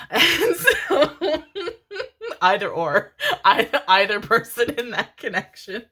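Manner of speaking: giggling hard while trying to talk; breaking into real laughter mid-sentence; laughing. Real, not acted. Laughter through speech.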